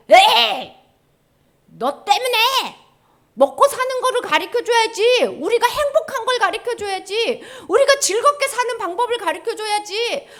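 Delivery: high, loud mock protest; indignant, high-pitched grumbling outburst; angry crowd complaining. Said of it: "화를 내는 거예요".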